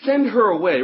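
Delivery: high, very loud demand to be rid of her; imitated begging. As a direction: beg loudly, as the fed-up disciples. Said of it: "his disciples came and begged him".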